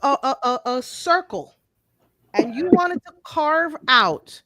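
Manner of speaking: high-pitched, halting spell-it-out delivery. Real, not acted. Host explaining slowly for guys who won't listen, deliberate. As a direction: break it into pieces for slow listeners. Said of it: "I'ma say it slower".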